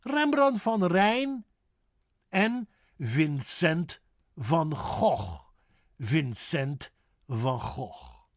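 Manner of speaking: "Vincent van Gogh" with a throaty Dutch G, slow; Dutch pronunciation. Shown as a demonstration. Teacher modelling Dutch names for learners, slow and clear. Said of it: "Dutch names modelled".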